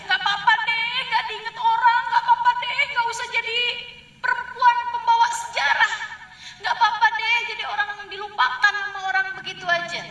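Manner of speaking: high, loud cheerful reassurance with laughs; consoling angel. Retold or imitated. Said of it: "malaikatnya itu bilangnya". (malaikat menghibur Maryam dengan riang)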